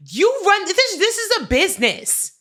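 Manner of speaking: loud, high, exasperated re-enactment; retold angry rebuke. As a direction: tell off the owner at high volume. Retells scolding the owner.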